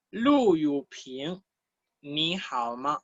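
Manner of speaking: very slow, careful Mandarin dialogue reading; drawn-out teacher's model. Shown as a demonstration. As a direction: read it out syllable by syllable for learners. Teacher models textbook dialogue line.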